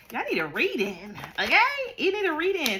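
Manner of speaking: high and loud "You need a reading"; emphatic exclamation. Real, not acted. Tarot reader exclaiming to Capricorns that they need a reading. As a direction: burst out at them.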